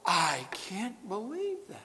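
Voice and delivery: a hint of hushed disbelief; slow, quiet mock astonishment; mock surprise. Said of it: "we're like".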